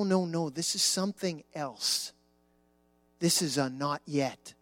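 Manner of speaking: ordinary talk, nothing marked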